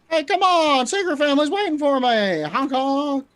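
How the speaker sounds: high goofy shouting driver; silly clown. Acted.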